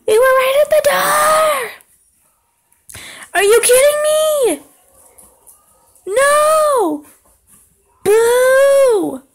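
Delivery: high, loud outraged shouting; frustrated yelling. Real, not acted. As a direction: yell it, furious you died at the door. Dying right at the door.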